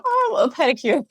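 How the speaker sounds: light, sing-song high voice; breezy high little answer; chirpy high reply. Retold or imitated. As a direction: act overly sweet and agreeable. Retelling her reply at nail salon.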